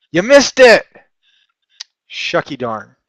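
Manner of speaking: hammed-up Southern teasing; put-on Southern accent. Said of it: "that is not my best accent".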